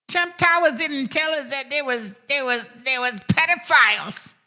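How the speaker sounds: high mocking voice, slow and dragged out. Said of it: "Cracker Barrels are like".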